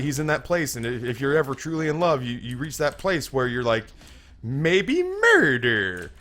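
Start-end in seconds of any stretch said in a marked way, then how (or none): 4.4-6.1 drawn-out, high-pitched musing on a dark idea; comic desperation. Acted. Lovesick man considering the unthinkable, comically desperate. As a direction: stretch it way out, pitched up.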